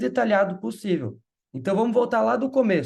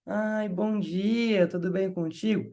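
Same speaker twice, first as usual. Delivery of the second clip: drawn-out, slow "bom dia", lightly voiced mother; sweet mother greeting; much slower than usual. Mother answering the coordinator's call, drawn-out friendly greeting. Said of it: "Mas agora se a mãe, ela fala".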